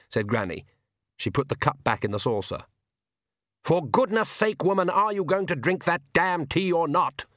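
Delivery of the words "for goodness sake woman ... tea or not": mild roar from the Count; a hammed-up roar of impatience. A narrator's reading. Count losing patience with Granny, a mild roar. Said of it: "roared the Count".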